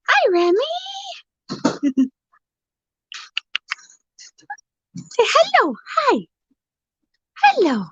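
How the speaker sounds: high cooing voice for a pet parrot; pet talk. Real, not acted. Greeting the parrot.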